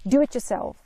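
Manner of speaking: mock irritated mother; irritated retort. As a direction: mimic mom's irritated brush-off. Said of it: "in an irritated manner".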